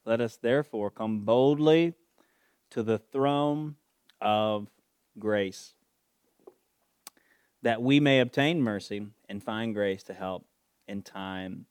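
loud preaching, slow on "throne"; preaching at full force. Acted. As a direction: proclaim the verse with power. Preaching the verse.

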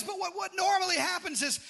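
normal talking voice

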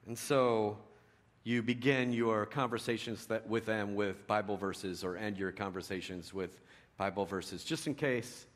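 ordinary talk, nothing marked

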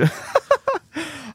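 giggling high; high laugh. Real, not acted. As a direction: keep laughing. Still laughing.

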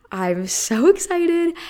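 so excited it spills into a laugh; giggly excitement. Real, not acted. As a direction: laugh through the word excited, slow and giddy. Gushing about her concert tickets.

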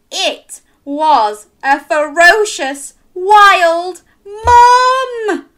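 slow, loud build-up; dramatic reveal. A narrator's reading. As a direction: draw it out to the reveal.

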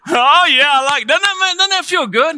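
high, loud, excited burst; elated. Real, not acted. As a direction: whoop it up with the crowd. Elated after the congregation shouts.

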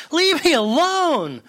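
high, drawn-out cry to be left alone; yelled protest. Acted. Teacup yelling at the master who rolls it, high protest. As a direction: yell it high, stretching the words. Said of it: "I yelled out".

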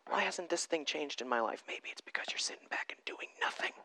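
hushed unvoiced whisper; whispered aside to the congregation. Real, not acted. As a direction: lean in and breathe it. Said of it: "dropped into a whisper".

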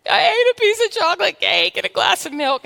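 high mock wail of a sobbing woman; imitating bawling. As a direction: play the weeping caller, hammed up. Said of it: "and she's bawling".

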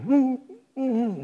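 high whimpering hum; high, closed-mouth dog whine; imitated dog whimper. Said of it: "he's just whimpering".

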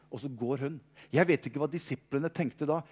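ordinary talk, nothing marked

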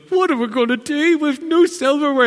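high, loud flustered housemaid voice; panicky housemaid. Retold or imitated. Preacher retelling the film does the housemaid, loud and high. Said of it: "the lady who's the housemaid's going".

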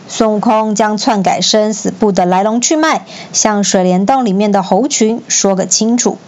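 ordinary delivery

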